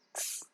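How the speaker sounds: "x" as a bare "ks"; a quick voiceless "ks" for "x"; K-S phonic sound. Shown as a demonstration. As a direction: say "x" as K run into S, no vowel. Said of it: "combination of k and s sound".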